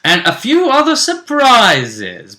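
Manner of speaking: high, loud, drawn-out teaser; teasing announcement. Real, not acted. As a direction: stretch it out to tease a reveal. Teasing surprises still to come.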